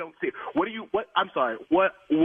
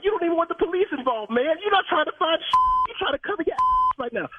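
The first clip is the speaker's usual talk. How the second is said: higher, fast heated accusing; angry accusation; 8 semitones higher than usual. Real, not acted. Car owner to manager, higher fast angry accusing. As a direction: call him out, furious.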